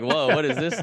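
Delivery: a loud, high, hammed-up whoa of disbelief; mock surprise. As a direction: replay the shock of realising it isn't what you were promised. Retold shock at ROTC reality.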